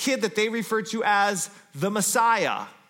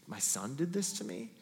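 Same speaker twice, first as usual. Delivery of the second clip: heartbroken whisper-soft question; 6 semitones lower, 11 dB quieter than usual. Retold or imitated. Isaac heartbroken.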